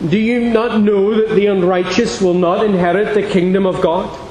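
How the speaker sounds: usual speaking voice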